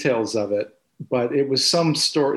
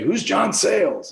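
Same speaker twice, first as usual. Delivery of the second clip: mock-gruff grandfather, pitched up; John Coolidge; 7 semitones higher than usual. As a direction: challenge it in playful, imitated gruffness. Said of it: "very gruff, low voice, John Coolidge".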